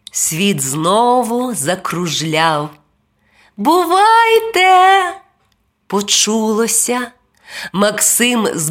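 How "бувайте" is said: high, loud, drawn-out goodbye; singsong high farewell shout; calling farewell. A narrator's reading. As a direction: call out from afar. Angel calls farewell as world spins.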